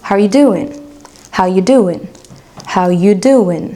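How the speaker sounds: HOW YOU DOING linked, the "are" dropped; "How you doing" run together as a casual American short form; shortened, no "are". Shown as a demonstration. Teacher showing learners the shortened greeting, "are" dropped. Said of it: "on va venir abréger, raccourcir".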